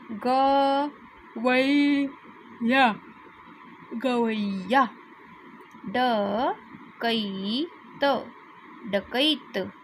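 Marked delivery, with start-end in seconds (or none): 0.1-3.0 गवैया drawn out slowly, every syllable held; slow sounding out. Shown as a demonstration. Teacher sounding out a word slowly for kids. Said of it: "तो आप इसे खाली त बोलेंगे". 5.9-8.3 डकैत stretched very slowly, syllable by syllable; slow sounding out. Shown as a demonstration. Teacher drawing out a word for young readers. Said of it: "तो आप इसे खाली त बोलेंगे".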